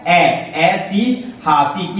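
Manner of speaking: ऐतिहासिक slowly, starting twice on "ai"; syllable-by-syllable demonstration. Shown as a demonstration. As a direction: sound out "ai... ai-ti... haa-sik" for ऐतिहासिक. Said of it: "क्या होता है?".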